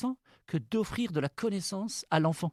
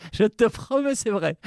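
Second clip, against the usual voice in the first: laughing; 5 semitones higher, 6 dB louder than usual. Real, not acted. Laughing at his own anecdote.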